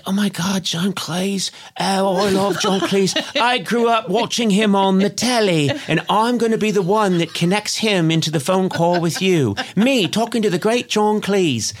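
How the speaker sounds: mock British accent, humbly thrilled; Colin. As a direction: gush like a starstruck Dickensian Englishman. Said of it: "And he's like".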